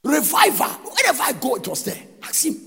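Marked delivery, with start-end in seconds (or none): none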